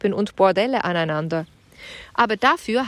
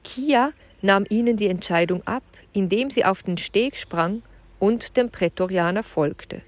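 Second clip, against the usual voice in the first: usual speaking voice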